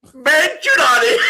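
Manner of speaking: high, loud mock French voice; laughing French mimicry. Host parroting co-host's French attempt, high and laughing. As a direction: echo the French accent loudly, breaking into laughter.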